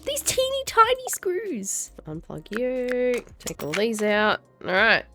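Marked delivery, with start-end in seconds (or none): 0.0-3.2 put-on much higher, slow, cutesy baby talk to the screws; squeaky tiny-voice coo. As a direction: coo at the teeny tiny screws like they're babies. Cooing at tiny screws in game.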